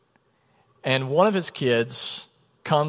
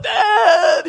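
a loud, much higher cry of joy; excited crying shout; 8 dB louder than usual. Retold or imitated. Son reunited with his dad after years, shouting with joy. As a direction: burst out calling your dad after years apart. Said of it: "the son's like".

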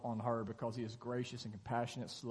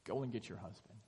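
quiet request; 3 semitones lower, 7 dB quieter than usual. Retold or imitated. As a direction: say it low, like a calm aside. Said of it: "Jesus responds by saying".

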